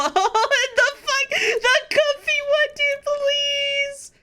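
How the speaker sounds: high giddy whine of delight; overwhelmed squeal. Real, not acted. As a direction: beg in giddy disbelief. Overwhelmed by the art.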